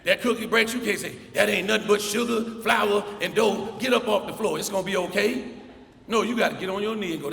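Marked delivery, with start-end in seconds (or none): 1.3-5.5 louder and higher, brusque tough-love voice; mock stern adult to a two-year-old; impatient scolding. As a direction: snap at the crying toddler.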